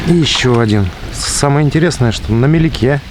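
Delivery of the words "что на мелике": ordinary talk, nothing marked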